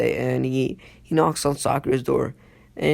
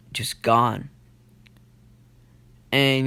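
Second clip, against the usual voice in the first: ordinary talk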